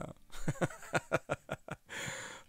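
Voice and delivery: airy laugh at himself; embarrassed chuckle at his own English; breathy laugh. Real, not acted.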